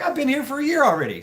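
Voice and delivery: fast, high-pitched chatter; excited reply. Retold or imitated. Friend catching up at a chance reunion, quick and excited. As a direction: rush it out in re-enacted excitement.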